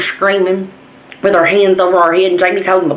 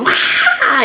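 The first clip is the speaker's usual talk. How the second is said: mock scream; 9 semitones higher than usual. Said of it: "I screamed".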